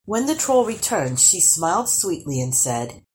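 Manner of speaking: ordinary delivery, nothing marked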